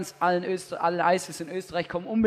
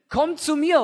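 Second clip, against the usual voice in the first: louder, higher call from afar; calling across; 7 semitones higher, 7 dB louder than usual. Acted. Jesus calls the blind man from across the street, loud. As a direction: call him over from fifty meters off. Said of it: "sagt zu dem Blinden".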